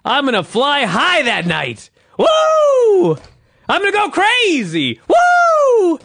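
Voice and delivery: high, shouted promo with piercing woos; crazed wrestler imitation. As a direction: shout it like a wrestler out of his mind. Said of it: "He's, he's out of his mind".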